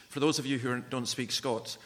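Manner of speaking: ordinary delivery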